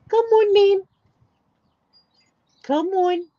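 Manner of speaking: high, loud call to the dog; loud, high coaxing call. Real, not acted. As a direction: call her over across the garden. Calling the dog to come.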